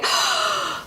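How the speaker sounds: sharp mock gasp of horror; startled intake of air; shocked gasp. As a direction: suck in a breath in shock. Retold shock at seeing bat.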